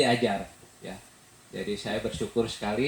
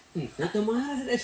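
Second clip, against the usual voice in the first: higher, laughing denial; laughing reply; 13 semitones higher than usual. Retold or imitated. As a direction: deny being angry through a laugh. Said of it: "negor ke anak".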